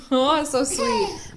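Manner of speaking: ordinary delivery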